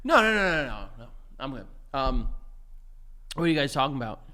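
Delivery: put-on mumbling drunk denial; low, quiet tipsy husband. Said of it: "I'm a little drunk".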